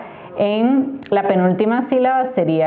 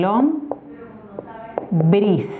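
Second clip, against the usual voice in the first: "lombriz" broken as lom, briz with a pause; split into syllables. Shown as a demonstration. Teacher dividing a word for learners, syllable by syllable. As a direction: say "lombriz" in two slow, separate syllables. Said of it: "La separaríamos en".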